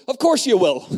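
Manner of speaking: bright laughing insistence; imitating a cheeky friend who won't take no; laughing quote. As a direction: laugh through her answer. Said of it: "she answers for them and says".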